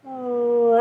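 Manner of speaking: ordinary delivery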